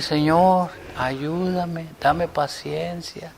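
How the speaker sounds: soft, humble plea to God; retold prayer. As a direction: pray quietly, as if alone with God. Retells his morning prayer.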